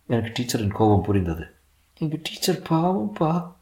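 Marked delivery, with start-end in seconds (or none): 2.0-3.5 put-on high, slow child voice; pitying small boy. A narrator's reading. Son pitying his teacher, small boy voice. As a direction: say it slowly as a sorry little boy.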